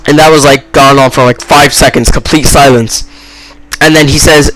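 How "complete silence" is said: usual speaking voice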